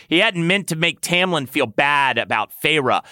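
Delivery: plain reading voice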